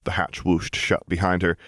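plain reading voice

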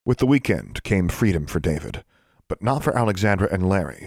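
ordinary delivery

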